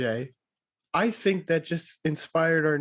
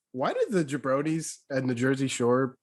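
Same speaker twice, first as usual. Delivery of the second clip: ordinary delivery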